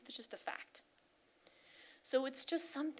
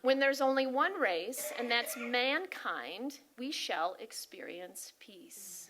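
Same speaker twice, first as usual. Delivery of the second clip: solemn altered quote; 5 dB louder, slower than usual. Acted. Her own version of the quote, slow and hushed at the end. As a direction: let the last line fall to a near whisper. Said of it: "I changed it up a little bit".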